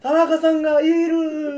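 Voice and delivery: high, loud, slow amazed cry; mock shock. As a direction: act stunned to find him there. Pretend shock at hidden person.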